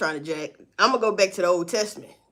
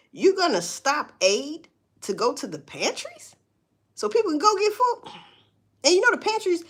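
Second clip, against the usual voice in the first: indignant disbelief; 6 semitones higher than usual. Real, not acted. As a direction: ask it like you can't believe it. Indignant rant about cut food aid.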